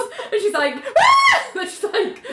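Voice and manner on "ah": sharp high mock scream; a startled shriek, played for laughs; retold scream. Ali startled by Wally, mock scream. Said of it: "she screams".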